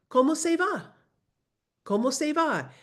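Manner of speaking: teacher drilling the question high; model phrase. Shown as a demonstration. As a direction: repeat it like a drill. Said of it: "Post it around your house".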